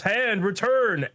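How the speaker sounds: high, drawn-out anime attack call; called-out command. Retold or imitated. Hero commands his armor's hand to return, calling out.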